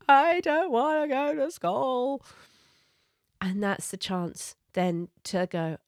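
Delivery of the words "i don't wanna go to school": put-on higher, louder, slow whiny child voice; protesting child. Child protesting to parent about school, whiny and raised. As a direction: whine it like a reluctant kid.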